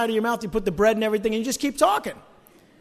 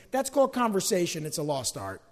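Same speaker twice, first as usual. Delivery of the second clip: ordinary talk, nothing marked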